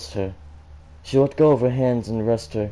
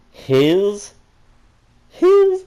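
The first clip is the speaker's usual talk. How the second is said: loud squeak, jumping much higher; high squeaky his, louder the second time; flustered yelp; 11 semitones higher, 10 dB louder than usual. Real, not acted. Reader breaks off flustered.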